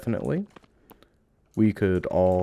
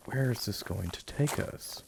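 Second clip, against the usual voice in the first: usual speaking voice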